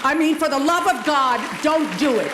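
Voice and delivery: exasperated plea. Real, not acted. Speaker begging graduates to drop a verbal habit, exasperated. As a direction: beg them in mock despair to stop. Pleading against overusing the word like.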